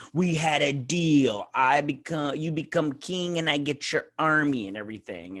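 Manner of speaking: a hint of a smug plotter; mock scheming villain. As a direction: ham up a villain reminding him of the deal. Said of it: "so he's all like".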